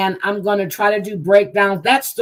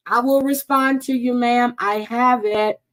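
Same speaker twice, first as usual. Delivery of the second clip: ordinary talk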